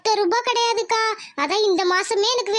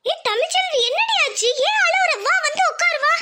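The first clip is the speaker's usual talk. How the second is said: high-pitched, worried questioning; alarmed concern; 7 semitones higher than usual. Acted. Friend receives crying Tamilselvi, alarmed and concerned. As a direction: fuss anxiously over an upset friend.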